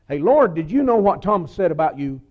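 hammed-up busybody reporting Thomas; mock tattling. Preacher mocking an imagined disciple telling on Thomas, loud and playful.

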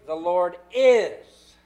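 IS drawn out and loud; slow, emphatic landing on "is"; stress on IS. Shown as a demonstration. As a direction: hold "is" long with a falling tune. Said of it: "Then thirdly".

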